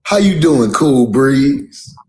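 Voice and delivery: high, loud smooth-talker greeting. Pretend. Greeting the host he'd call Cool Breeze, smooth and high. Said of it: "I would call you".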